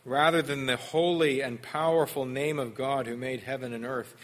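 ordinary talk, nothing marked

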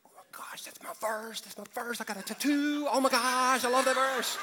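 high, rising squeal from a whisper to loud; squealing delighted fangirl, laughing at the end; mock gushing fan. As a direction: gush like a thrilled fan over a tattoo verse. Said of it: "Nobody in the room went".

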